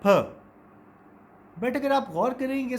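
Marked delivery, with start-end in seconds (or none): 0.0-0.4 फ said loud with a hard puff, as people often say it; common wrong sound. Shown as a demonstration. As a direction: demo the common फ for the p symbol. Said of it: "अक्सर लोग कहते हैं उसको". (फ, जैसे लोग अक्सर कहते हैं)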